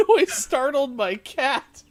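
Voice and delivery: high, loud, slow through laughter; helpless giggling stretches the words out; breaking up laughing. Real, not acted. Host reports the cat got startled, breaking up laughing. Host cracking up after demo.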